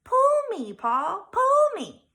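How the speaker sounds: high, loud tempting little voice; squeaky temptress pigtail. A narrator's reading. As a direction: coax him like a tiny imp. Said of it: "a little voice coming from the pigtail".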